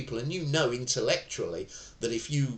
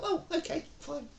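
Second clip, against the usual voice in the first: mock meek acceptance; 4 dB quieter than usual. Anger deflates, mock acceptance.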